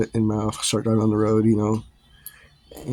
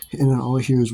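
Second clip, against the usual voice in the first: normal talking voice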